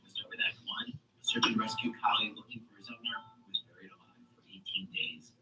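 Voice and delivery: low, soft radio presenter voice; public radio host. Acted. Host introducing a Puppy Love episode, hushed radio voice. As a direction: introduce the programme softly and low.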